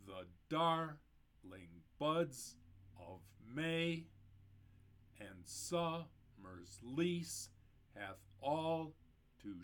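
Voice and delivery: slow, every stressed syllable of "Shall I compare thee to a summer's day" punched hard; hyper-emphasized stresses. Shown as a demonstration. Teacher reading Shakespeare's sonnet to show the meter, beats hammered slowly. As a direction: butcher the sonnet by hammering each beat. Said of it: "hyper-emphasizing the stressed syllables".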